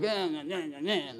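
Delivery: wordless imitation of petty grumbling; mock whining. Preacher imitating Israelites who forget days later, mock whining. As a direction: mimic complainers with softened whiny nonsense syllables.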